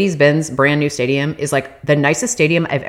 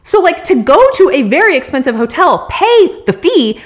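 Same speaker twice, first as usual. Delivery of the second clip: angry rant; 9 semitones higher than usual. Real, not acted. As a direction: vent about the useless hotel. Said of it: "I was just fucking angry".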